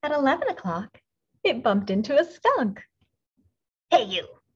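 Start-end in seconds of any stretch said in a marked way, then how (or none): 3.9-4.4 bossy little bug calling out; grouchy ladybug. A narrator's reading. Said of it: "said the grouchy ladybug".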